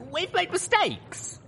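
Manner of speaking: put-on high, slow young mare pushing back; countering. A narrator's reading. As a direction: answer back slowly and firmly. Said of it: "I countered".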